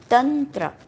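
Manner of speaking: "Tantra" said slowly as TUN-truh, short vowels; correct pronunciation. Shown as a demonstration. Teacher repeating the proper word, correct pronunciation. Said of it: "it's tantra".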